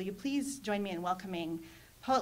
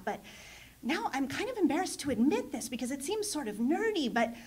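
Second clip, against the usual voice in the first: acted-out student, much higher pitched, building to a loud gushing wow; sheepish student confession, imitated; 7 semitones higher, 6 dB louder than usual. Student confessing to a professor after a poetry reading, sheepish then amazed. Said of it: "sort of sheepishly confessed".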